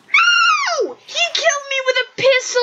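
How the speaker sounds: high, rushed complaint after a shriek; exasperated high-pitched gamer rant; outraged protest. Real, not acted. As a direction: cry out in disbelief and keep it high. Protests being killed by a pistol.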